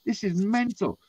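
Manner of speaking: high-pitched retold disbelief; incredulous. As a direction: relive being stunned by what you watched. Retelling his stunned reaction watching.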